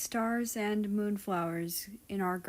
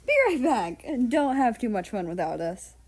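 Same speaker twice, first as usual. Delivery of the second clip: calling out; 3 semitones higher, 7 dB louder than usual. Acted. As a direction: call it out cheerfully across the room. Calling out to friends leaving.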